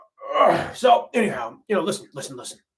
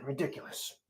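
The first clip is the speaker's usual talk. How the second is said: ordinary talk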